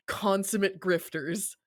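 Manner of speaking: normal talking voice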